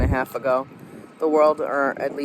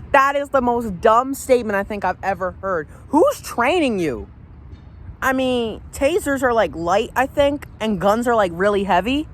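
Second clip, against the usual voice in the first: high, heated ranting; indignant, pitched-up outrage; mad; 7 semitones higher than usual. Real, not acted. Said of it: "this makes me mad".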